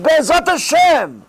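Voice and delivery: high, slow, drawn-out "Be'ezat Hashem"; the student leaning on faith, lifting the words; hopeful student. Retold or imitated. Student answering the rabbi, hopeful and drawn-out. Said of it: "He says".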